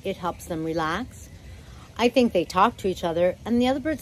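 ordinary delivery

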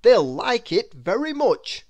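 an enthusiastic writer; lightly voiced author. The author telling Mister Small about the book.

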